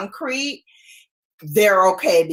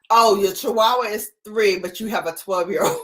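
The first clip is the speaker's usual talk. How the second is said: ordinary talk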